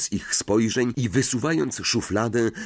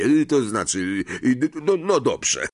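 higher, stumbling reluctant agreement; flustered hesitation; 5 semitones higher, 3 dB louder than usual. A narrator's reading. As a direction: hem and haw before giving in. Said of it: "ogromne zmieszanie".

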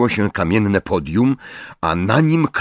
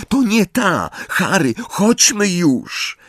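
high-pitched anxious plea; frightened girl urging a friend away; 11 semitones higher, slower than usual. A narrator's reading. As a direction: plead in a scared, raised pitch. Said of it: "Miała wystraszony głos".

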